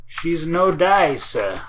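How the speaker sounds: slow, drawn-out mock Italian accent. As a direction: put on a playful Italian accent, stretching words. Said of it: "That's my Italian accent".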